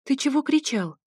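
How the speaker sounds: a woman half-awake asking why he yelled; woman's voice. A narrator's reading.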